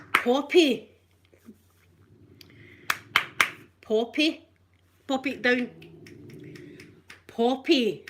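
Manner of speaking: loud call across the house; calling the dog. Real, not acted. To her dog Poppy, calling out loudly. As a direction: shout the dog's name to fetch her.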